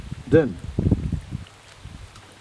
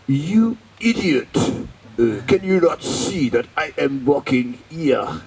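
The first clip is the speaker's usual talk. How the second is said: put-on angry French-accented scolding, slow; 2 semitones higher, 4 dB louder, slower than usual. Head pujari scolding the devotee in a French accent. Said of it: "he said with a sweet French accent".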